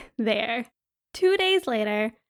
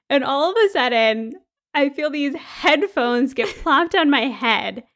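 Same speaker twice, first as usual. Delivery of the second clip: usual speaking voice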